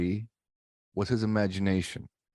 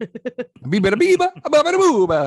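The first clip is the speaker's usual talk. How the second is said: hammed-up Italian accent gibberish; mock Italian gibberish; 9 dB louder than usual. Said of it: "Peter Griffin did a better one".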